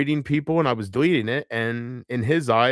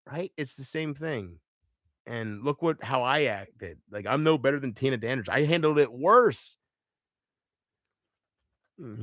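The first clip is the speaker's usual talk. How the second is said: ordinary talk, nothing marked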